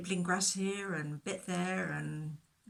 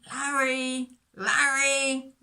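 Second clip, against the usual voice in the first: loud, higher call across the hills; 6 semitones higher, 10 dB louder, much slower than usual. Acted. Shepherd searching the hillside, calling loudly for his lost sheep. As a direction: shout the name to find him. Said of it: "he called out as he went".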